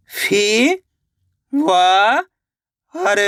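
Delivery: isolated, held sounds with long pauses; teacher slowly spelling out by sound; syllable by syllable. Shown as a demonstration. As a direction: sound out each syllable separately for learners. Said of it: "एक अक्षर का उच्चारण करके".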